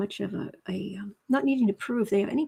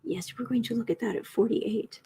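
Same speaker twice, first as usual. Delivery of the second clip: ordinary talk, nothing marked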